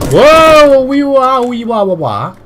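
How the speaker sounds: high, loud wavering wail that falls away; drawn-out whooping cry, sliding down; long wailing whoa. Pretend. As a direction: wail "whoa" into a siren-like wee-wah. Mock alarm while moving objects.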